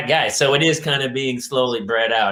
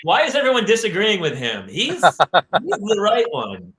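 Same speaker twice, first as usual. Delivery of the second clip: lightly mimicked indignant dad, pitched up; hammed-up bewildered defence; imitated baffled father; 6 semitones higher than usual. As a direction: protest in mock disbelief, dragging the last words. Imitating father defending Archie Bunker.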